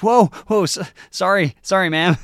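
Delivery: high, loud flustered apology; startled young man. Acted. Young man nearly collides, blurts apology. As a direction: stammer out a startled sorry. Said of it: "And he goes".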